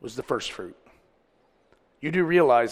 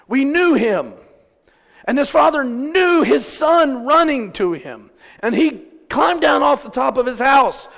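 raised, high-pitched preaching; full-force preaching; 10 semitones higher, 6 dB louder than usual. Acted. Preacher likening his family to the waiting father, proclaiming. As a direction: proclaim it with rising fervour.

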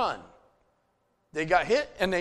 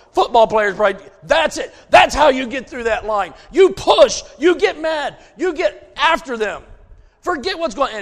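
loud, higher coach-like shouting; fired-up shout; 5 semitones higher, 8 dB louder than usual. Real, not acted. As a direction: pump the crowd up like a coach. Preacher fired up, football image.

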